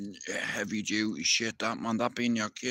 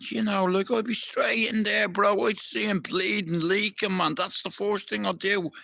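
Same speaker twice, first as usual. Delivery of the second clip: much higher, keyed-up voice; pumped, ready to jump straight in; fired up; 8 semitones higher than usual. Real, not acted. Fired up about helping.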